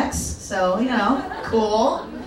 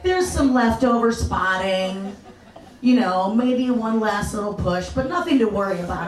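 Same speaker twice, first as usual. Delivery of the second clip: usual speaking voice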